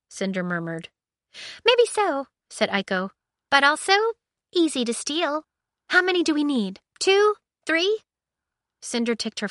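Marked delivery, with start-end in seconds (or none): 1.6-8.0 high, bright eager android voice; high excited AI chatter; chirpy ship AI. Acted. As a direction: pitch it up as an excited ship computer. Aiko voiced.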